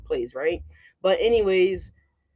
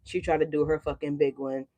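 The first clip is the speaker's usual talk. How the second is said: muttered repeat; 4 semitones lower, 7 dB quieter than usual. Real, not acted. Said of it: "She did".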